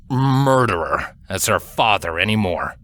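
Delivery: plain reading voice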